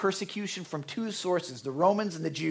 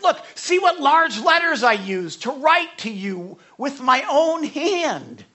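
slow, loud, higher voice of Paul writing; Paul's voice; 7 semitones higher, 7 dB louder, slower than usual. Retold or imitated. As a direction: lay it out slowly like Paul. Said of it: "he's like".

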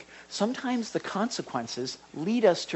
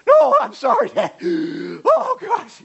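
high, loud mock wail; panicked begging; 13 semitones higher, 9 dB louder, slower than usual. Kid begging Dad after punishment grows, panicked. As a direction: beg desperately in a hammed-up kid's squeal.

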